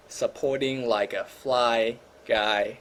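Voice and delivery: slow drawn-out slogan. Pretend.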